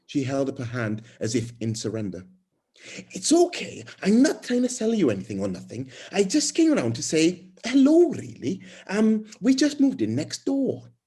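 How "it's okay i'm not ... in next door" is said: high, lively neighbour introducing herself; friendly new neighbour. Acted. New neighbour introducing herself on the doorstep, high and eager. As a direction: pitch it up high, a chatty newcomer.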